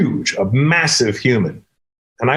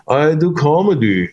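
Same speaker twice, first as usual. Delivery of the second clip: put-on slow, higher foreign-accented English; thick imitated European accent; 4 semitones higher, slower than usual. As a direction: drag it out in a mock accent. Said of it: "he had an accent".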